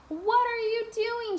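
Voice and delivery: high, startled cry of a donut about to be eaten; alarmed shout. Acted. Donut Arnie shouting at Mister Bing about to bite him, alarmed. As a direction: yell it out in panic. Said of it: "shouted Arnie".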